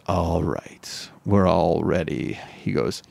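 lightly voiced low, slow game host; deadpan instructions, slow and low; low guide voice. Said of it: "And then he goes".

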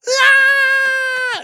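high loud shriek; hammed-up scared scream; mock scream. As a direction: shriek in fake terror. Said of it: "camera catch you being like".

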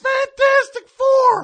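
loud, slow announcer-style call; excited shout. Acted. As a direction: shout the title with big excitement. Excited movie title exclamation.

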